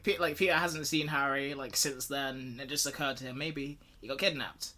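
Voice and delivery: ordinary talk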